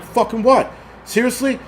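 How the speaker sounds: high-pitched, indignant disbelief; incredulous challenge. Retold or imitated. Driver challenging the staring scooter rider, incredulous. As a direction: challenge him, voice jumping up.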